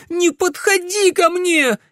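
very high, slow shriek; terrified scream. A narrator's reading. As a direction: scream to keep him away. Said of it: "крикнула Камилла". (крик ужаса, почти визг)

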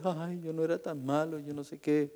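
soft mock-whining excuse; whiny excuse. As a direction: whimper an excuse without repenting. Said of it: "van a llorar".

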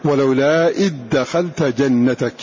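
إذ دخلوا said "iddakhalu", with a doubled د; dh merged into d. Shown as a demonstration. Teacher showing students: إذ دخلوا as "iddakhalu". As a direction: close the ذ straight into the د. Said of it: "فنطقناها".

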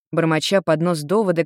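ordinary delivery, nothing marked

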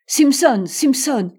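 loud, high call of a name. A narrator's reading. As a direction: call after someone getting away. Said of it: "appela Mortimer".

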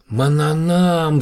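high, loud, drawn-out cry of the name; exclaiming shout. A narrator's reading. Yura recognizing the alien's brother's name, loud drawn-out shout. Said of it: "закричал Юра".

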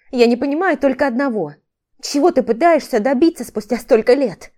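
heated but controlled defiance; defiant rebuff. A narrator's reading. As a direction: stand your ground against him. Ex-wife argues, rejecting his remarks.

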